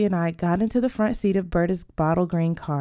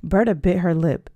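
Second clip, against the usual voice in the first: ordinary delivery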